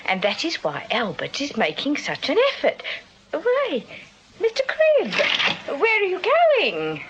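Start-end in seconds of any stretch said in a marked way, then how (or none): 3.3-7.0 high, slow startled question; a much higher, drawn-out surprised call. Acted. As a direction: catch him as he leaves, surprised. Landlady startled as he leaves.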